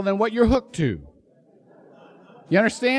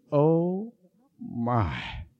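put-on awed country kid; 5 semitones lower, 4 dB quieter than usual. Kid awed by shiny car.

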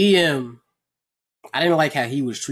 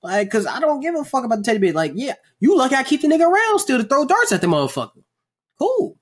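swagger through it, pitched way up; E A voiced; 10 semitones higher than usual. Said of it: "E A like".

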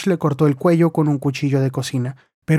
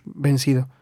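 ordinary delivery, nothing marked

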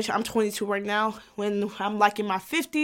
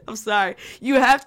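ordinary delivery

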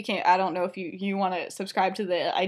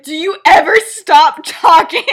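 loud, higher, exasperated question; exasperated outburst; 6 semitones higher, 14 dB louder than usual. Retold or imitated. Crying girl turns on talkative boy, loud mock exasperation. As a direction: burst out at a chatterbox, mock-exasperated.

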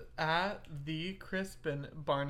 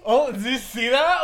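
loud laughing delight, much higher; Oh, did you see that? said with a big delighted laugh; 9 semitones higher, 15 dB louder than usual. Real, not acted. Friend reacting to an accent video, laughing loudly. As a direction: burst out laughing while speaking.

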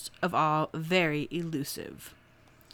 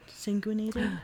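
whisper; 6 dB quieter, slower than usual. Real, not acted. Unsure word, whispered aside.

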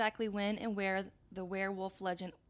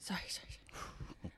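whispered sheepish apology; whispering; 2 semitones lower, 11 dB quieter than usual. Pretend. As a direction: whisper sorry three times. Whispered apology after swearing joke.

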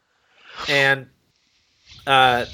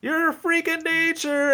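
high, slow marvelling voice; mock amazement; slower than usual. Host quoting her awe at the dog, high and slow. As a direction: draw it out up high. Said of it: "she was like".

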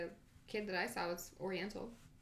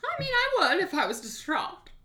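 emphatic; 11 semitones higher, 11 dB louder than usual. Real, not acted. Emphatic opinion.

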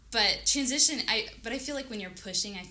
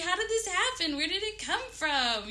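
high, loud burst of delighted surprise; gasping astonishment, easing slightly towards the end; ecstatic amazement; 11 semitones higher, 8 dB louder than usual. Retold or imitated. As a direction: relive the shock of discovering a baby. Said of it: "and I was ecstatic".

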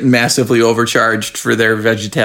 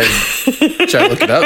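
laughing; 13 semitones higher, slower than usual. Real, not acted. Laughing self-question.

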